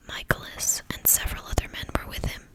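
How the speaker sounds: usual speaking voice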